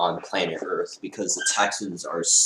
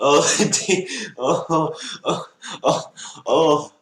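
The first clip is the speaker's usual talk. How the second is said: high, loud "oh"s broken by laughter; gasping with laughter, higher pitched; laughing disbelief; 7 semitones higher, 3 dB louder, slower than usual. Real, not acted. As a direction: laugh through the words in disbelief. Laughing disbelief at Texans pick.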